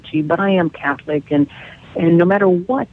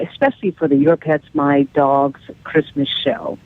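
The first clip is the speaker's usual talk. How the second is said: ordinary talk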